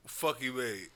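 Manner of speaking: ordinary talk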